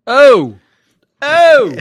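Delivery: loud, high mock-shocked Oh; hammed-up outrage at the letter; mock outraged gasp. Real, not acted. Host gasps at the listener's letter, mock shocked.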